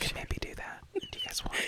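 very soft teasing whisper; ASMR whisper. Pretend. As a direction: whisper close to the listeners, playfully. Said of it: "this isn't A S M R".

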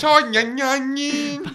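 put-on high, drawn-out silly kid voice; childish TikToker impression. Guest mimicking a boy TikToker for friends, high silly voice. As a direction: stretch it out high like a silly little character.

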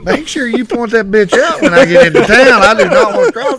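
high, loud retold plea; mock alarm. Asking coworker to point out his wife, high loud alarm.

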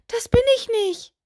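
high-pitched protest of innocence; calling out. A narrator's reading. Witch denies to the doll that she spoke, calling out. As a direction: call out a high, quick denial. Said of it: "rief Miep Miep".

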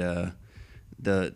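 ordinary talk, nothing marked

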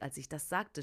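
usual speaking voice

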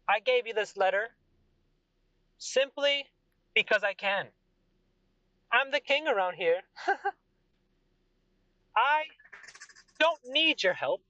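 high, proud, boastful king voice; arrogant king. Acted. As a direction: play the smug king who only cares about money.

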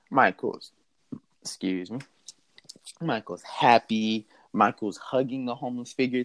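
plain reading voice

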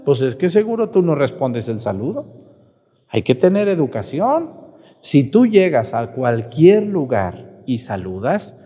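ordinary talk, nothing marked

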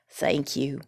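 low, grave scarecrow thanks; gravely. Acted. Scarecrow thanks Dorothy for offering to help, gravely. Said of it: "he said gravely".

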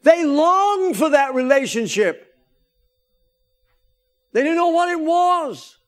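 high, loud preaching to the congregation; full-force preaching. Acted. Pastor preaching covenants to his church, in a high, loud voice. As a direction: preach it out with fire.

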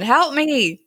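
high mock cry for help; high-pitched pretend scream; retold scream. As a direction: imitate a screaming boy, without full volume. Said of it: "And Walt's screaming".

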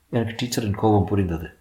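ordinary delivery, nothing marked

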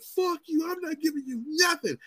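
high-pitched imitation of an angry girl; angry outburst. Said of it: "she was so angry".

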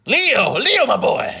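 loud, high, gleeful shout; excited cheer. Real, not acted. Greeting favorite actor, cheering.

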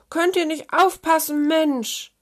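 high, loud telling-off; sour, exasperated outburst; indignant shout. A narrator's reading. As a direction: yell at them to watch out. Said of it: "riefen sie aus einem Mund".

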